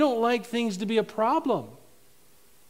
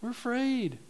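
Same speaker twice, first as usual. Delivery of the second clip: ordinary delivery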